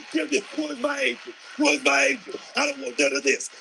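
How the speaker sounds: mock panic. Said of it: "You understand?".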